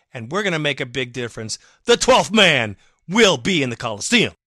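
loud fired-up rally cry to the fans; emotional rallying. Real, not acted. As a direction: pump up the home crowd. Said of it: "Sorry, I got a little emotional".

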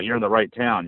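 ordinary talk, nothing marked

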